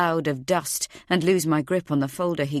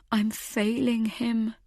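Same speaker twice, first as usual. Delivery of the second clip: slow, higher-pitched anguished lament; soft, pained self-reproach; despairing; 5 semitones higher, 3 dB quieter, slower than usual. A narrator's reading. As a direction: say it slowly, close to tears. Despairing thought about her boy.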